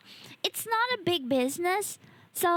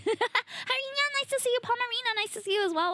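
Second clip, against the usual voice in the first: high, sweet VTuber greeting; cute anime streamer; 10 semitones higher than usual. Real, not acted. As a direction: greet them in a cutesy high voice. Greeting chatters.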